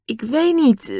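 high, uncertain, trailing into "eh"; hesitate, unsure of the answer; doubtful and hesitating; hesitant. A narrator's reading. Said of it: "zei Nobby aarzelend".

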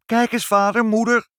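put-on high, loud child's call; excited calling out. A narrator's reading. Child throwing the door open to parents, calling excitedly. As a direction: call the parents to come and look. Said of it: "en riep".

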